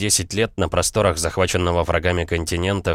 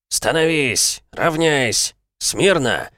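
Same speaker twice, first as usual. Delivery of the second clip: stiff barking toy soldier; 8 semitones higher, much slower than usual. Acted. Drill commands on parade.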